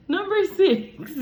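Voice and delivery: high, loud, slow announcement; announcer. Real, not acted. Host announcing the next question, game-show style.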